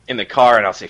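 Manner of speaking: ordinary delivery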